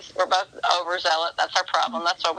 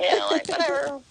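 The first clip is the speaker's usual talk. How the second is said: dismissive; 8 semitones higher than usual. Retold or imitated. Dismissive about ignoring advice.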